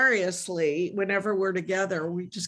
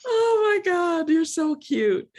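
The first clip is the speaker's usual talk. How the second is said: laughing; 7 semitones higher than usual. Real, not acted. Laughing at the accent bit.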